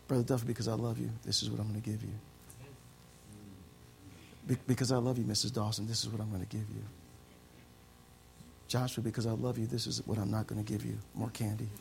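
soft, low, tender speech; gentle voice of God. Pretend. God speaking tenderly to his children. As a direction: speak lovingly, like a father.

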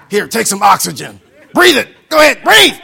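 loud, sarcastic push to breathe; mock shove. Grudging giver shouting at Brother Duffy to breathe. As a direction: shove it at him, mocking.

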